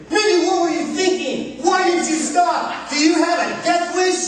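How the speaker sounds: exasperated mother scolding a reckless driver; ballistic. Retold or imitated. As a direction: let the wife tear into the daughter. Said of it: "She goes ballistic".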